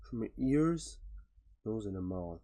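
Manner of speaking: soft, slow disbelief; stunned quiet. Real, not acted. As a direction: murmur it, taking it in. Stunned by injuries described.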